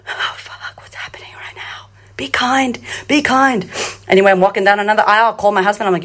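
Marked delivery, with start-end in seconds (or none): 0.0-1.9 gasping, very soft whisper; panicked whisper. Retold or imitated. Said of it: "new me is like". 2.2-3.7 strained mantra, rising out of a whisper; self-coaching. Retold or imitated. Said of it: "new me is like".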